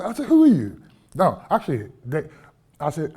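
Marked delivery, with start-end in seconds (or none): 0.3-0.8 higher, louder retold challenge; mock-defiant who are you. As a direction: confront the stranger head on.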